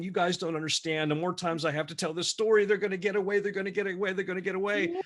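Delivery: anxious, high repeated pleading; panicked insistence. Retold or imitated. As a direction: keep pushing the words up in desperation. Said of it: "I was so nervous and upset".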